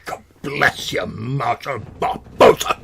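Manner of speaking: gruff, slow, loud proclamation; gruff shouty voice. Acted. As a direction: bellow the name like a drill sergeant. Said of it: "a gruff, sort of, like, shouty voice".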